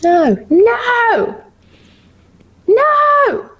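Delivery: climbing shocked no; rising no. Real, not acted.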